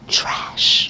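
whispered Trash, no voice; a mock whispered taunt. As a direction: breathe the word Trash as a sneaky whisper.

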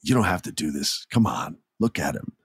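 soft, low, gentle pleading; hushed acted appeal; sweet, tender plea. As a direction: act it small and quiet, begging a friend to stop. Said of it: "I'm acting this the best I can".

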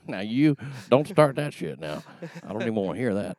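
playful mock-Southern accent. Shown as a demonstration. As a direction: slip into a casual, scolding Southern voice. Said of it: "me doing a Southern accent".

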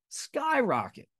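high, ringing "Skyrocket"; soaring emphasis. Real, not acted. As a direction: throw the word up into the air. Echoing the word for emphasis.

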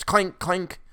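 two high-pitched metallic clanks; imitated clanks. A narrator's reading. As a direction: voice a basin bouncing downstairs. Imitates a basin rolling downstairs.

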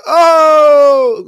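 high, loud "Oh!" like a hit; mock tackle shout. Pro player bursts in with a mock tackle yell. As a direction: yell it as if making a big hit. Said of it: "hit him with the Superman".

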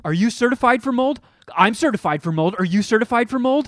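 loud, high aggressive demand; mock angry landlord; angry confrontation. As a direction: get in his face and bark it. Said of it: "He got right in my face".

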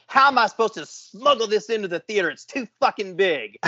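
high-pitched, exasperated mock complaint; mad wife. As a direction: imitate a fuming wife griping at her husband. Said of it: "she was mad".

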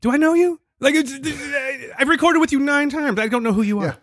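high-pitched, put-on confusion; mock bafflement. Imagined Steve not recognizing a caller, high mock bafflement.

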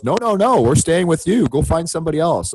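high-pitched imitation of a refusal; mock urgent on-air insistence; insistent broadcaster. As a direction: push back in a high, hurried-sounding host voice. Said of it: "And he goes".

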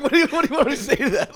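high, loud, fast and laughing; mock exasperated coach. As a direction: rattle it off in disbelief. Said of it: "He's like".

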